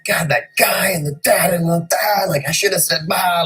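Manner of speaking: mock grumbling about a petty grudge; mock inner rant. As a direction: vent like a petty grudge in your head.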